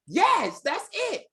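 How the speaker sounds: excited shout. Retold or imitated. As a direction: cheer it out like she nailed it. Teacher cheering student's remark.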